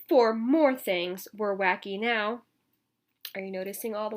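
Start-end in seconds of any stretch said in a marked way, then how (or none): 0.1-2.4 lively storybook read-aloud; reading to children. A narrator's reading. Teacher reading a picture book to children, lively. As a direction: read it out to the kids with bounce.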